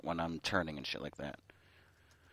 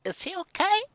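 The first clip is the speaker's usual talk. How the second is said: put-on high, worried girlish squeak; mock-worried high voice; concerned friend voice. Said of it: "she was like".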